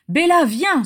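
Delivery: high, urgent plea; pleading. A narrator's reading. Régulus begging Bella to come, pleading. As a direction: beg her loudly to come. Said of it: "supplia Régulus".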